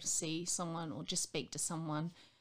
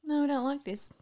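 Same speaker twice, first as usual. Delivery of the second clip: put-on higher, louder childish refusal; mock body refusing; 8 semitones higher, 4 dB louder than usual. Her body rejecting meat, mock higher refusal. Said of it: "my body's like".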